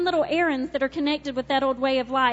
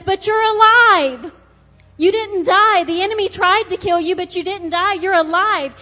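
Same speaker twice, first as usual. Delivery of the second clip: triumphant shout; 3 semitones higher, 5 dB louder than usual. Acted. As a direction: shout the victory to the crowd. God's triumphant cry.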